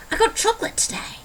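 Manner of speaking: high, excited put-on British accent; switched British accent. Vlogger announcing chocolate, high mock-British accent. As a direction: squeal it in pretend British. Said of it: "I just switched British accents".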